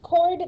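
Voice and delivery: "chord" with a hard k sound, H silent. Shown as a demonstration. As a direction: say "chord" like "cord". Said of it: "The H is silent".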